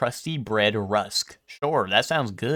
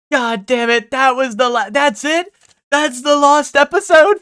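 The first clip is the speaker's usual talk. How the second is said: much higher, louder, wailing mock pirate despair; Patchy the Pirate crying; 9 dB louder than usual. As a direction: squeal it like a heartbroken cartoon pirate near tears. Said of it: "I'm like Patchy the Pirate after the Lost episode".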